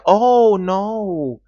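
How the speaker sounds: high, loud "oh" falling away; mock dismay. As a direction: act out Lily's horrified protest, overdone. Retold dismay at being accused.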